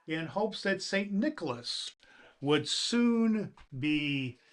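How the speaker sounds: lively storybook recital with dramatic pauses; poem reading. Acted. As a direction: read it like a bedtime poem to children. Reads the classic poem aloud.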